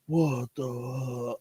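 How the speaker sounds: hushed mock disbelief; imitated shock. Speaker imitates family's reaction to the episode, stunned. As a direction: softly act out a stunned reaction. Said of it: "looking at my family and, and the reaction".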